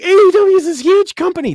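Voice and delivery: sarcastic high-pitched parody of fans; mocking quote. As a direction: scoff at the fans' bragging. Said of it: "everybody's like".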